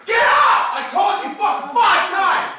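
real screaming rage at an intruder; furious yelling. Real, not acted. Roommate ordering an intruder out, furious loud yelling. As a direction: yell him out of the house.

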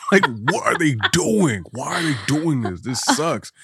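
high, loud incredulous outburst; hammed-up bewildered complaint; retold exasperation. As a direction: act out being baffled and fed up. Retelling his bewildered reaction.

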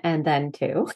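usual speaking voice

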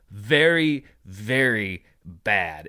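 "very, very bad" spaced out, stepping down in pitch; dramatic emphasis. Real, not acted. Host revealing Morbius's second weekend, dramatic emphasis on "very, very bad". Drumroll answer to his question.

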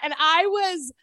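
usual speaking voice

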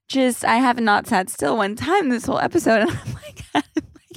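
giggling through the words, flustered; laughing. Real, not acted. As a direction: laugh it out, losing the thread. Rambling, flustered over her bra.

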